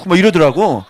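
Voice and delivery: ordinary delivery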